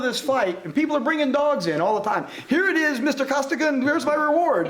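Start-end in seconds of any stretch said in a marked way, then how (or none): 2.5-4.7 eager reward-seeking local. Retold or imitated. Locals bring dogs for reward.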